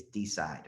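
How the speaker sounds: "decide" said DEE-side, Tennessee style; Tennessee pronunciation. Shown as a demonstration. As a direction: say "decide" as two distinct syllables, DEE-side. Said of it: "the way we say it in Tennessee".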